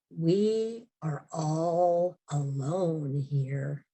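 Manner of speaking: slow, low forlorn man's voice; imitating a forlorn stranger, very slowly. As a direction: draw each word out, low and desolate. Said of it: "it was very forlorn".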